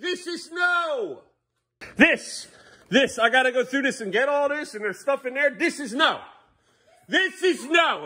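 high-pitched scolding shout. Real, not acted. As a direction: raise your voice, stretching out the rebuke. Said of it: "I'm really gonna raise my voice here".

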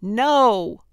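loud, high, drawn-out refusal; exasperated shout; annoyed holler. A narrator's reading. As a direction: holler it at the order box, fed up. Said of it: "Gertrude hollered at the box, well beyond annoyed".

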